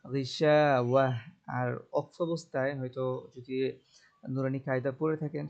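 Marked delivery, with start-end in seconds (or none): 0.1-1.1 غشاوة slow, with the alif after shin stretched one count; غشاوة, hold the alif one beat; long alif demo. Shown as a demonstration. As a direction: draw out the shaa in غشاوة. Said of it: "এক আলিফ টান দিতে হবে".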